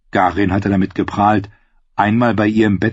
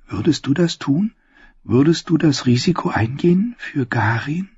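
fairy tale princess; 6 semitones higher than usual. A narrator's reading. Princess tempting the servant.

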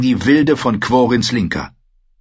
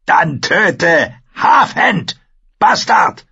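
slow, high, loud order; shouted command; 9 semitones higher, 5 dB louder, much slower than usual. Acted. Wildling leader orders the bastard to kill, shouting. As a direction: bellow the kill order word by word.

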